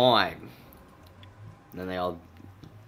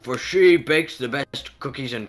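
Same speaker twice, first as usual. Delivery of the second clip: put-on slow, louder old-fashioned pleading; theatrical child plea; 2 semitones higher, 5 dB louder, slower than usual. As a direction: ask father dearest with mock formality. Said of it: "Father dearest".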